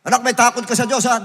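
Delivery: loud, high mock-parent call; calling to a child. Shown as a demonstration. Preacher mimics a parent calling to a child, loudly. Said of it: "Ikaw mismo, takot ka sa Diyos".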